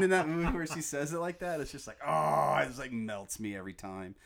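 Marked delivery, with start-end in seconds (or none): none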